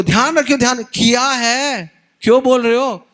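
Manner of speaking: a wife snapping back at her husband; irritated wife. Retold or imitated.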